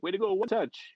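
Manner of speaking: high, loud mock cheer; teasing call-out. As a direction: razz a teammate across the ice. Teammate razzing him in practice.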